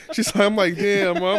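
high, loud "damn, Ma"; loud exasperation. Retold or imitated. Son reacting loudly to his mom's complaints. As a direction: cry out at mom, fed up.

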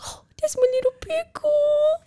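high cooing voice to a puppy; cooing baby talk. Retold or imitated. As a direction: coo it like talking to a baby. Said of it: "And I was like".

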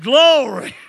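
loud, high "glory" burst; exultant praise shout. Retold or imitated. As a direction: holler it like a revival response. Acting out his answering praise shout.

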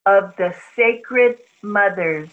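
drawn out slowly; slow intoned chant; slow mantra. Acted. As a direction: stretch each word for her to echo. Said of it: "I'll say it very slowly".

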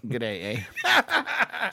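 hammed-up Aussie G'day with a laugh; a bad mock Australian greeting; jokey fake Aussie accent. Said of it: "What are you doing, Australia?".

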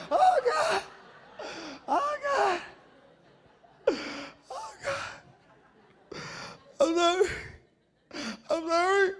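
very high, wailing mock plea; begging sister. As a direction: beg in a squeaky little-sister voice.

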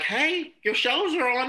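high-pitched imitation of calling the dog; excited calling voice. Imagined owner telling the dog its shows are on, high excited call. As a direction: pitch it way up to call a pet over.

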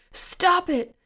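loud, high-pitched desperate scream; yelled. A narrator's reading. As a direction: scream it, pleading for it to end. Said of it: "Joanne yelled".

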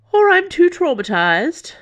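high, loud, theatrical complaint; mock traumatized. Prisoner lord excusing himself to his guards, mock traumatized. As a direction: play up the trauma in a swooping high voice.

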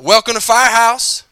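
loud, high store welcome call; shouted greeting. Retold or imitated. Restaurant worker shouting a welcome at the door, loud and high. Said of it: "they shout".

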